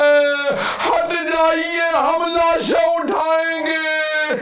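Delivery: chanted, sobbing climax of the mourning recital; wailing lament. Acted. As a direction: cry the lament out slowly.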